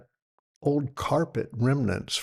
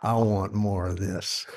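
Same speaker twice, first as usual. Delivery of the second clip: low awed whoa; 6 semitones lower, much slower than usual. Retold or imitated. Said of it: "And I was like".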